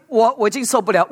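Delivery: ordinary talk, nothing marked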